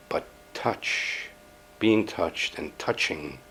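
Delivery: soft, low, slow savouring; hushed and drawn out, almost to oneself; intimate hush. Acted. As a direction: linger quietly on the thought of touch. Dwells intimately on being touched.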